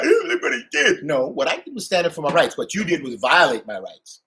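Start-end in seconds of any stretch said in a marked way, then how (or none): 0.0-1.0 high, slow, louder mocking whine; mock crybaby. Mocking the boss who cried to Freddie, high whiny mock crying.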